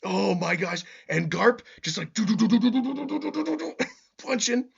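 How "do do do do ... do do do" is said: high, climbing rapid-fire "do-do-do"; rapid punch noises. Retold or imitated. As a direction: imitate a flurry of punches, rising in pitch. Imitates rapid punches landing.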